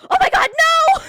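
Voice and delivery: panicked high shout of disbelief; scream of fright. Retold or imitated. Retelling a jump scare in the movie theater, screamed in fright. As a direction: shriek it, very high and very loud. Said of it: "I actually screamed in the movie theater".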